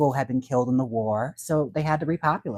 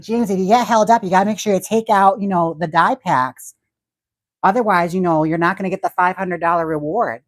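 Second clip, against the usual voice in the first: put-on higher, louder bank manager with a Midwestern accent; imitating bank manager; 5 semitones higher, 6 dB louder than usual. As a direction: mimic a comical Midwestern bank manager briefing staff. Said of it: "a bank manager that spoke like that".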